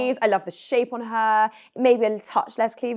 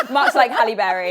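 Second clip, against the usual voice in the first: laughing; 3 semitones higher, 7 dB louder than usual. Real, not acted. Laughing joke.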